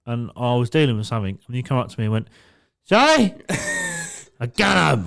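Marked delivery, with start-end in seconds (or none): 2.9-5.1 put-on a high, loud drunk voice; mock drunk friend. Drunk friend greeting the bouncer outside, mock drunk. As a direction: play the tipsy mate.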